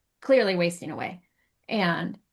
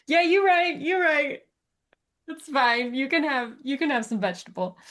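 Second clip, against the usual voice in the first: playful giving in; 6 semitones higher than usual. Pretend. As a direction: give in to the dog in a playful voice. Owner giving in to dog.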